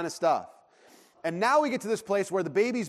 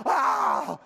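imitated five-year-old's shriek; sudden mock scream, cut short; retold child's scream; 4 dB louder than usual. Dad imitating his hungry son's meltdown, mock scream. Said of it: "My five-year-old is screaming".

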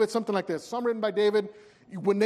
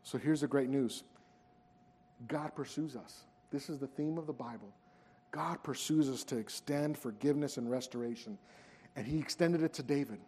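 hushed preaching; 7 semitones lower, 11 dB quieter than usual. Acted. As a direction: lean in and let it land quietly. Quiet reflective sermon climax.

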